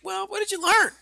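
very high put-on coaxing voice; high-pitched question to a little kid; parent asking child. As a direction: coax a small child in a high voice. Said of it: "And I was like".

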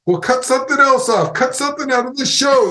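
raging at the producer, spit flying; furious boss yelling. Retold or imitated. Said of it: "he'd get really mad at me and be spitting in my face".

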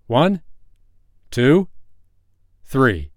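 playful loud countdown; counting out loud. Acted. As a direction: call out the count, raising the voice. Counting before dunking her.

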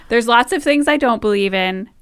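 normal talking voice

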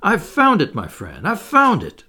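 high, loud burst of discovery; elated outburst, dipping on the aside; excited cry. A narrator's reading. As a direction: exclaim it like a eureka moment. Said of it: "he cried at last".

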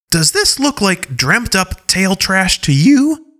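high, slow challenging question; defiant young wolf. Acted. Skyla showing her wound to Alpha Paw, defiantly. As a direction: throw it back at him.

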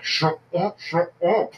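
urgent hushed scolding. Retold or imitated. Inner voice scolding brother.

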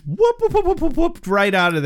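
high, bouncy run of whoops; scurrying sound effect; comic whooping. Retold or imitated. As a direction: whoop upward like a cartoon getaway. Imitating someone scurrying away.

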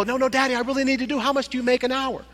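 put-on high, pleading child voice; insistent little boy. Little boy pressing his father for an answer, high and pleading.

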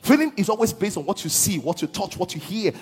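loud, half-sung declaration of scripture; fervent preaching. Acted. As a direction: proclaim the verse with rising fervor. Preaching rises again, half-sung.

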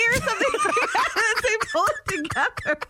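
high, fast, laughing through tears; laughing protest. Real, not acted. Tearful mom laughing at the host's jab, high and fast. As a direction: protest while still laughing.

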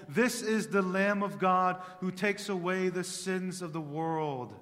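this is the Lamb of God cried out loud and high, this stressed; loud fervent declaration; full-force preaching. Acted. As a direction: proclaim it like John crying out. John the Baptist quote cried out.

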